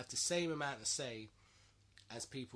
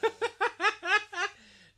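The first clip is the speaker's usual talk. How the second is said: loud high laugh; 17 dB louder than usual. Real, not acted. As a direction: burst of shrieking laughter. Laughing at friend's disgust.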